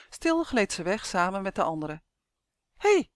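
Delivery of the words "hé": high, loud squeaky "hey"; squeaked call. A narrator's reading. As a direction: pipe up sharply to call them back. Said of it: "piepte Justin". (hoog piepend roepen)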